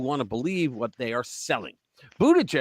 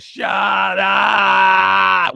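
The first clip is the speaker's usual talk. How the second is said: loud, higher mock scream; movie-line yell; 7 semitones higher, 9 dB louder than usual. Shouting the Kindergarten Cop line at the kids. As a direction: yell it like Arnold at the class. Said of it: "He goes".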